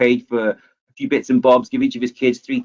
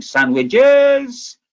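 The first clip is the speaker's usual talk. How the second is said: street vendor shout; 12 semitones higher than usual. Retold or imitated. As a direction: call it out like a sandwich seller. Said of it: "he walked into there shouting".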